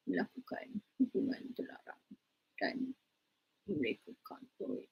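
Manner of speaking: soft whisper, secretive; whispered gossip. Shown as a demonstration. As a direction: lean in and whisper the scandal. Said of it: "Mana ada orang yang macam tu".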